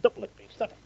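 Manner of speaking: high-pitched yelp of protest; startled protest. Real, not acted. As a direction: jerk back from a licking dog. Dog licking him.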